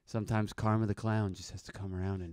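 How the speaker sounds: a hint of a sly dad; quiet sly mock menace; lightly voiced goofy dad. Dad's Karma the Clown persona.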